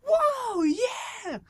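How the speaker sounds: put-on high excited whoop of a kid. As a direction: whoop it like you just pulled off a stunt. Friend excited after big jump.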